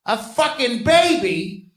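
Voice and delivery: hammed-up teacher building to a shout; fifth grade sex ed teacher. Teacher explains the diagram to the fifth grade, high and building to a shout. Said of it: "She's like".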